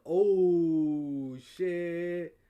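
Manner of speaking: loud, long stretched "oh"; drawn-out retold shock. Learning they're both guests, drawn-out retold shock. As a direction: draw out the dawning realization. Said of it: "I was like".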